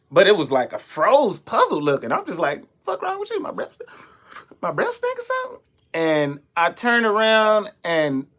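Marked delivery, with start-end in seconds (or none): 2.8-5.6 high, squeaky disbelief; incredulous squeak. Retold or imitated. Teller to the girl, high squeaky disbelief. Quoting himself to the staring girl.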